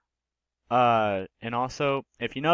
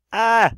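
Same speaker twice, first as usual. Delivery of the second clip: startled yelp; 12 semitones higher, 11 dB louder than usual. Real, not acted. Reacting to the roast video.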